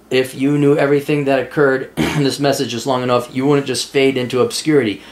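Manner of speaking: low, monotone read-out; reading a message aloud. Acted. Host reading a viewer's message about family, low monotone reading. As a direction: read it out evenly, pitched well under your talking voice.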